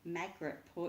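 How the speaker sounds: ordinary delivery, nothing marked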